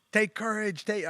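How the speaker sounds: high-pitched chant-like call; rally cry. Retold or imitated. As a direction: imitate a group rallying round the bonfire. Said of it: "it was a rally cry".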